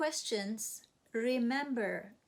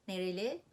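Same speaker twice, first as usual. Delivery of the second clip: Nereli with the pitch rising at the end; rising question tune; faster than usual. Shown as a demonstration. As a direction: ask Nereli with a clear upward lift. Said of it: "nereli means where is this person from".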